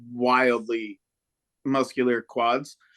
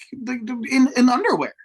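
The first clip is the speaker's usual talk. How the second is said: louder, higher burst of disbelief; nearly shouting the punchline; outraged exclamation; 8 semitones higher, 6 dB louder than usual. Real, not acted. Host outraged at wrestlers in underwear, loud exclamation.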